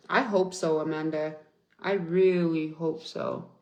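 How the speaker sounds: usual speaking voice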